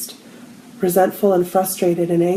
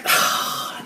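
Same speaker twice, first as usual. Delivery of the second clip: breathy whispered dismay; 6 dB quieter than usual. Retold or imitated. To herself, dreading the task: whispered oh. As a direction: gasp it out under the breath.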